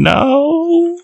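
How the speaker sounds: long, very high wailed no; mock scream. Boyfriend watching his vinyls crash down, mock screaming. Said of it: "and he's screaming".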